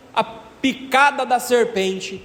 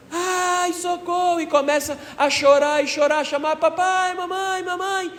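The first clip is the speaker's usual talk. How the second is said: put-on much higher pitched child voice crying for help; frightened child crying out; 10 semitones higher than usual. Bitten child screams for help to parents in the yard, high childlike cry. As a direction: play a little child bitten by a snake, calling for papai and mamãe. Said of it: "A criança grita".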